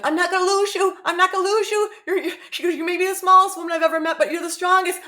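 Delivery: very high, urgent pleading voice; desperate surgeon. Retold or imitated. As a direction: refuse to lose her. Surgeon fighting to save her.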